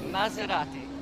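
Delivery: Maserati said the Italian way, open ah vowels; Italian pronunciation. Shown as a demonstration. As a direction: Maserati with stress on the ra, Italian style. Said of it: "The proper way to say it is".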